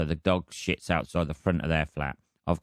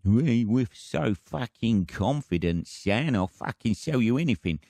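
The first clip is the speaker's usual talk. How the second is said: higher, hammed-up Cockney geezer voice; mock Cockney; 5 semitones higher than usual. Mocking cocksure Cockneys who sell anything, exaggerated Cockney accent. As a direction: parody a Cockney, drawn out at the start. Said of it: "cockneys, man".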